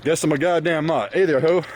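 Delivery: ordinary talk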